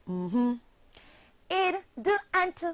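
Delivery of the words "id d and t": the sounds "Id", "d" and "t" said high and separate; teacher modeling sounds. Shown as a demonstration. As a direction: spell out "Id", "d", "t" one by one for the class. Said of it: "you're going to join in with me".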